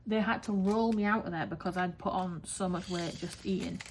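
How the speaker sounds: normal talking voice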